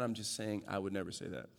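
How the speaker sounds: muttered aside. Real, not acted. Joking denial to congregation.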